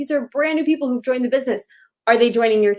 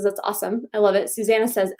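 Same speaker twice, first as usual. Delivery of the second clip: ordinary talk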